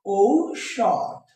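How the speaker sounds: very slow ঔষধ, "ou... shodh"; diphthong sounded out. Shown as a demonstration. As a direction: glide o into u at the start of ঔষধ. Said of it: "তাহলে কি হবে".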